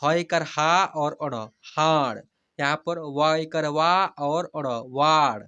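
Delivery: very slow teacher's demo, long stretched vowels; slow spelling-out. Shown as a demonstration. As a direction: spell each letter slowly, then draw out the whole word. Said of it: "जैसे मैं हिजे करा कर पढ़ रहा हूँ". (धीरे-धीरे हिज्जे करके, स्वर खींचकर)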